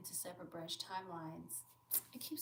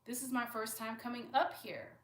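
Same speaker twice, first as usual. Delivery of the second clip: confused protest; 4 semitones higher, 7 dB louder than usual. Retold or imitated. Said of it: "She's like".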